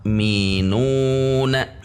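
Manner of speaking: "yu'minuna" slowly, nu held in one long swing; menekan pada nu. Shown as a demonstration. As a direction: lean on the nu of "yu'minuna". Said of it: "menekan pada nu". ("yu'minuna" dengan nu ditekan satu ayunan)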